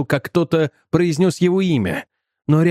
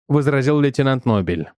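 ordinary delivery, nothing marked

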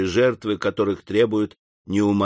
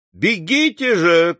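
крик; 5 semitones higher, slower than usual. A narrator's reading. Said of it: "крикнула мадемуазель Дармельи".

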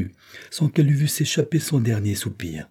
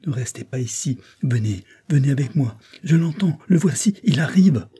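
higher, building to louder urgency; pressing, agitated warning; urgent alarm; 6 semitones higher, 4 dB louder than usual. A narrator's reading. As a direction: hurry her away before he comes. Servant urges her away, master coming.

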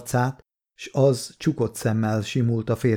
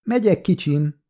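put-on higher, louder call to her child; mother shouting back to the child; louder than needed; 6 semitones higher than usual. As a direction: call out so the man outside hears. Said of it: "kiáltotta kelleténél hangosabban".

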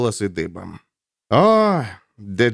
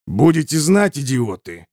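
snapping; 3 semitones higher than usual. A narrator's reading. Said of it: "огрызнулся Рыжий".

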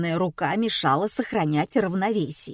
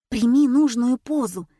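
plain reading voice

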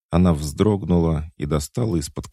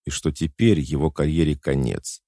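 ordinary delivery, nothing marked

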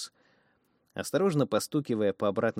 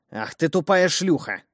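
slow, loud and higher angry growl; 8 semitones higher, 9 dB louder than usual. Acted. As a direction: snarl it out loud. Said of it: "Селия натурально зарычала".

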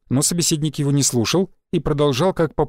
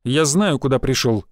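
ordinary delivery, nothing marked

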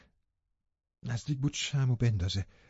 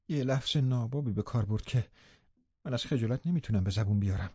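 ordinary delivery

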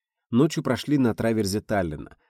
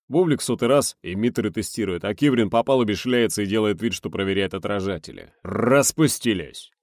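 gruff boatswain grumbling; 5 dB louder than usual. Acted. Boatswain complaining about crew. (ворчливый боцман)

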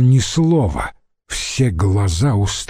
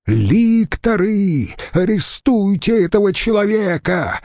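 furious cry; 9 semitones higher than usual. A narrator's reading. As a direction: call the lictors in high-pitched rage. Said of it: "дрожа от гнева, выкрикнул тот". (яростный выкрик)

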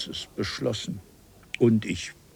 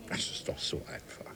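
whisper; 14 dB quieter, faster than usual. Acted. Exasperated explaining to slow Parisian.

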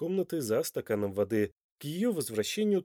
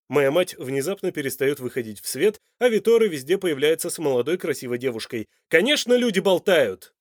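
loud, heated sarcasm, building higher; sarcastic; 3 semitones higher, 8 dB louder than usual. A narrator's reading. Man explains the rumour to the woman, sarcastically. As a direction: spell out the gossip scornfully. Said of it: "саркастически заявил Данте".

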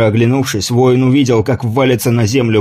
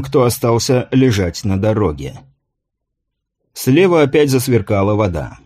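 ordinary delivery, nothing marked

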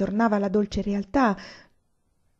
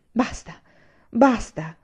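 raised-pitch cry of exasperation; exclaiming; 4 semitones higher, slower than usual. A narrator's reading. Anania cuts the grandmother off, exasperated exclamation. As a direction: exclaim it twice, building in force. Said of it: "esclamò egli".

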